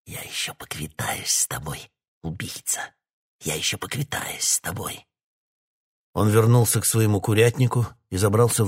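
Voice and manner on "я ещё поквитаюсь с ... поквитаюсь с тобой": whispered, much softer threat; barely audible mutter. A narrator's reading. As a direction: breathe the threat right in his face. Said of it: "пробормотал едва слышно".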